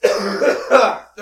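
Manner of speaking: loud hacking cough, choking on coffee; clearing a choked throat hard; coughing fit. Real, not acted. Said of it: "I just choked on my coffee".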